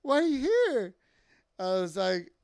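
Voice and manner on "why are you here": imitated astonishment, high and loud; high-pitched, incredulous question; excited, confused surprise. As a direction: act out a partner's happy bewilderment. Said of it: "her excitement, but confusion at the same time".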